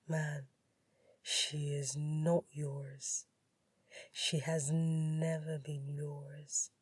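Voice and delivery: slow, low, soft rival's voice; quiet, unhurried taunt; low drawl. A narrator's reading. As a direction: drawl it with poised, possessive certainty. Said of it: "said in that low drawl".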